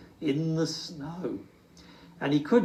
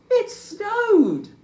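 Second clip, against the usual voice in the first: very high, loud delighted cry; excited cry; 7 dB louder than usual. Acted. Little Bear sees the snowy window, crying out high and loud. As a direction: cry it out in pure joy. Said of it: "he cried".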